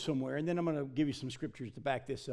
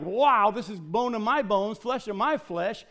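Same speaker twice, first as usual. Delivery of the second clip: excited Adam; 4 semitones higher, 6 dB louder than usual. Acted. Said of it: "he was like".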